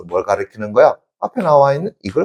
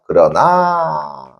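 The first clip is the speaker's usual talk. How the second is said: higher, with a long held ending; drawn-out reading; 5 semitones higher, much slower than usual. Shown as a demonstration. Teacher has class mark the word, drawn out. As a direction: stretch the word out very slowly.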